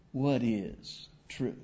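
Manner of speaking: hushed, slow doubting question; slow musing Pilate. Shown as a demonstration. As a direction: Pilate asking "What is truth?" slowly, as if to himself. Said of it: "I don't know the inflection of Pilate's voice".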